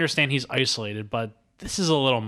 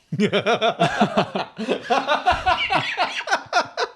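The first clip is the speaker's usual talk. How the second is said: long high cackling laugh; helpless laughter; 11 semitones higher than usual. Real, not acted. Bursts out laughing at incel remark.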